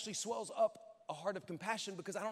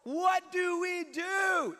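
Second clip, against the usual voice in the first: very high, loud frantic shouting to Elijah; panicked shout; 10 semitones higher, 8 dB louder, slower than usual. Acted. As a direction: shout in panic, slowing on the last question. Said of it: "he runs in and he goes".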